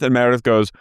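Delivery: usual speaking voice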